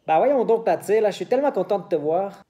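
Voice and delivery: put-on loud, high, fast Quebec accent; Quebec accent imitation. Teacher imitating a Quebecer greeting a friend, loud Quebec accent. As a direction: burst into an excited Quebec accent. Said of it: "intentar de imitar un poquito l'acento del Québec".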